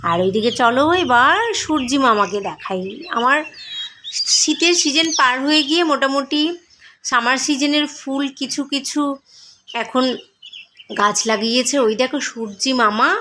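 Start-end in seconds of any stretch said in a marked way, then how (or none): none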